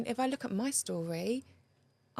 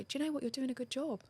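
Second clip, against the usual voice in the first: higher and softer, like comforting yourself; gentle self-encouragement; 6 semitones higher, 5 dB quieter, faster than usual. Pretend. Inner voice reassuring a tired mother, soft and high. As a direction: tell yourself kindly you're doing well. Kind self-talk to oneself.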